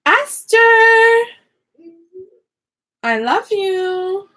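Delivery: high, loud call, then a slow, sweet "I love you"; calling out to Esther. Real, not acted. Mom calling to her daughter Esther from the craft room. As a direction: call her name across the house, then soften.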